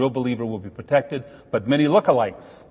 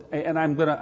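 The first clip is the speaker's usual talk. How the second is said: normal talking voice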